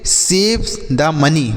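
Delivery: slow, clear question; slow example. Shown as a demonstration. Teacher slowly models the question for children. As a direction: draw the question out. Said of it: "जैसे".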